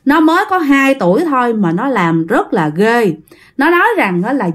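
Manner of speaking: ordinary delivery, nothing marked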